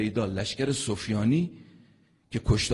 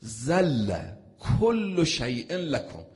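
«ذل کل شیء لکم» drawn out very slowly and louder, every word weighted; slow recitation; 5 semitones higher, 7 dB louder, much slower than usual. Shown as a demonstration. Preacher reciting the Arabic line to the crowd, very slow and weighty. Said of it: "ذل یعنی ذلیل".

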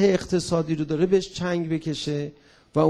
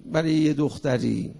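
ordinary talk

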